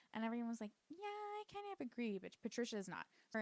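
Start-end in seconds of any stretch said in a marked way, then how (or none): 0.9-2.2 high mocking agree.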